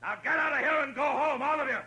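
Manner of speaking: stern lawman yelling at a crowd to disperse; commanding shout. Acted. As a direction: bark the order at the mob, high and hard. Marshal orders crowd off street.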